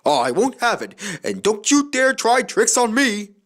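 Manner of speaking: stern warning. A narrator's reading. Skarloey warning coaches.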